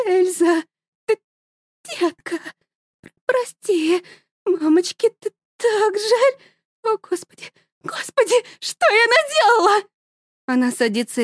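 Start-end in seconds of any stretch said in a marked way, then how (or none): 0.1-9.9 broken sobbing. A narrator's reading. Mother sobs over her shot daughter, high and stammering. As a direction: fall apart in remorse.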